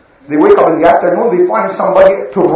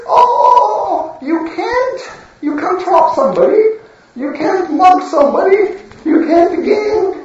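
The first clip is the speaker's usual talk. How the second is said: very high whiny mock voice; mocking imitation; 11 semitones higher than usual. Gang parodying the prophet's warnings, very high whiny mockery. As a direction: whine it out as a parody. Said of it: "and they say".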